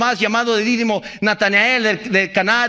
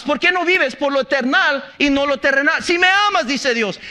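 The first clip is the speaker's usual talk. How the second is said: ordinary talk, nothing marked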